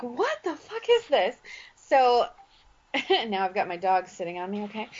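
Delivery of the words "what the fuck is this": incredulous. Retold or imitated. Reacting to a badly written message.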